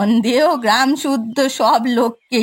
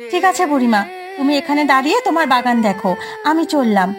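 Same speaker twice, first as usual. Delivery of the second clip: put-on young man voice; 2 semitones higher, much faster than usual. Neighbour leaving.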